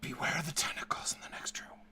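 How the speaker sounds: hushed, eerie unvoiced whisper; subtle whisper. Game master as the relic's voices warning the player, whispered. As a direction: whisper it like ghostly voices in the ear. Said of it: "you hear subtle whispers".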